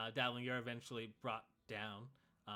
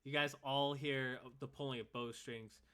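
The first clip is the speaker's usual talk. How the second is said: ordinary talk, nothing marked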